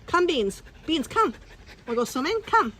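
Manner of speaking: high, sing-song call to the dog; bright dog-coaxing call up high. Real, not acted. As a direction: coax the dog into the water. Calling dog to the pool.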